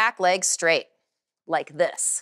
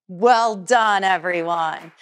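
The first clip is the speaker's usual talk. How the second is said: ordinary talk, nothing marked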